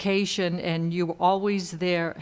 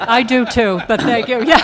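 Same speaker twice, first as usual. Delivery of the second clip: laughing loudly while answering; 5 semitones higher, 11 dB louder than usual. Real, not acted. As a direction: laugh through the words. Laughing at joke about husband.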